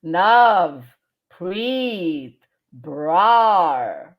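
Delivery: each syllable stretched and set apart; robot syllables. Shown as a demonstration. Teacher splitting a name into three syllables, robot-like. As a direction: sound out "nav", "preet", "brar" like a slow robot. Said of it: "speak like a robot".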